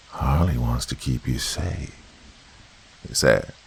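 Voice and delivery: eerie voice inside her head, ending in a whisper; creepy childlike tone. A narrator's reading. Creature speaks from inside the girl, eerie then whispered. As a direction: murmur it possessively, last words whispered. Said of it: "The creepy childlike tone".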